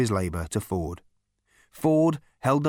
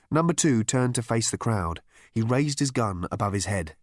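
plain reading voice

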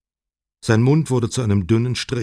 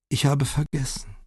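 put-on frail old lady; 5 semitones higher than usual. A narrator's reading. Confused old wife speaks.

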